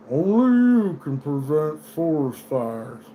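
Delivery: slow, drawn-out PSA slogan; calm mascot voice, slow; Smokey slogan. Retold or imitated. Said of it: "Smokey the Bear's like".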